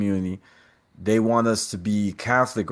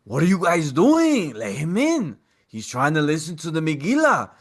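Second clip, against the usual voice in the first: the rabbi stepping in; 6 semitones higher than usual. Retold or imitated. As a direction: play the rabbi scolding the boys. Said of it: "The rabbi comes down".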